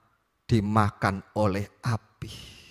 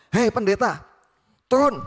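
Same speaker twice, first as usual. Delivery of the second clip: much higher, louder rude command; arrogant shout; 13 semitones higher, 8 dB louder than usual. Acted. Soldier ordering the pastor down, arrogant shout. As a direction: snap the order like a bully. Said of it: "Dengan arogan, dengan kasar".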